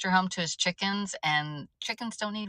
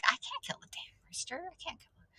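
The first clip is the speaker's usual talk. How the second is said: breathy whispered protest, high; 9 semitones higher, 10 dB quieter than usual. Retold or imitated. Whispering to her brother that she can't kill the rooster. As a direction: whisper it, shaking your head at the idea. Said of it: "but I'm like".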